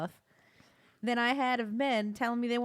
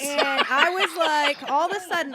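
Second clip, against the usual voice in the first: laughing through the words, much louder and higher; 7 semitones higher, 10 dB louder than usual. Real, not acted. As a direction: burst out laughing mid-sentence. Laughing while retelling the story.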